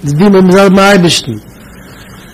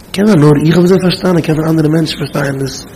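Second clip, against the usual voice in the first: normal talking voice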